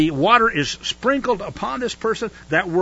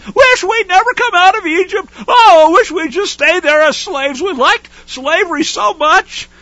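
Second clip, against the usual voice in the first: high, loud whining complaint; mock whiner; 12 semitones higher, 7 dB louder than usual. Teacher mocking Israelites complaining to Moses, high whining. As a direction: whine like a spoiled crowd, much higher and louder. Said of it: "Whiners all".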